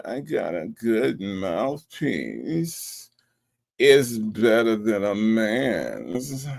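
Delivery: slow, drawn-out mock seduction; mimicked sexy drawl. Host showing Keisha Marie how she said it, mimicked sexy drawl. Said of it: "she said it like this".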